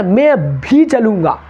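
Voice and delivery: भी drawn out and stressed, said slowly; teacher leans on भी in "मैं भी चलूँगा". Shown as a demonstration. Teacher showing students stress on भी, slow and emphatic. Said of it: "अब मैं पे जोर डालना".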